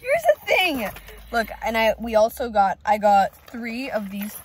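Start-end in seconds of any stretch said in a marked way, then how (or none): 0.0-0.9 high excited vlog voice. Real, not acted. Laughing, restarting the vlog.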